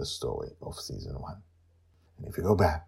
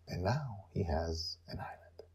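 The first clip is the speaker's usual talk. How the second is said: whisper; 10 dB quieter than usual. Real, not acted. Whispered teaser.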